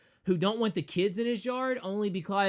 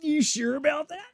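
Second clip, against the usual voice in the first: mock teasing, higher and louder; smart-aleck; 9 semitones higher, 4 dB louder than usual. Jesus teasing his mom about the wine, smart-aleck. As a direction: play it smug and sarcastic. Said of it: "he was a smart aleck".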